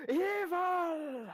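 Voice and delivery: high, loud, drawn-out mock-horrified cry. Hobbit bolts upright on seeing his shoes, horrified cry. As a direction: wail it in hammed-up outrage.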